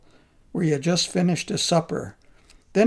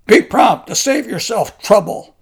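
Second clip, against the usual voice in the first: loud, higher gruff warning; gruff grumble; 4 semitones higher, 9 dB louder than usual. Acted. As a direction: growl the warning at him. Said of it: "Ocean Brightfellow grumbled".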